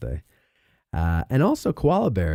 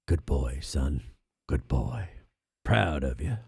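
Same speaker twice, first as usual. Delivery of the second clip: very low, soft, slow-spoken dad impression; father looking down; 10 semitones lower, 6 dB quieter, slower than usual. Late father looking down on his son, deep and hushed. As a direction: drop deep and quiet like an approving father. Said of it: "he's saying".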